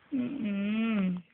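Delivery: normal talking voice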